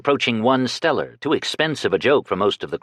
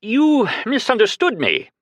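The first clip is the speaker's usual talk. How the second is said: frightened plea; 13 semitones higher than usual. A narrator's reading. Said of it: "he gasped".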